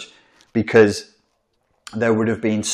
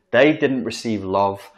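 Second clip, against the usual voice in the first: ordinary delivery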